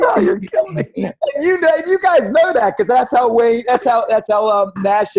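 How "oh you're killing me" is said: high, gleeful mock cry; imitating Jeff's giddy delight at the camera; excited like a little boy. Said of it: "He's like".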